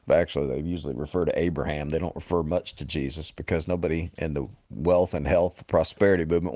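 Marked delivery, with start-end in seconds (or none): none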